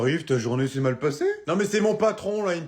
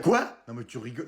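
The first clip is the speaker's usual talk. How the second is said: ordinary talk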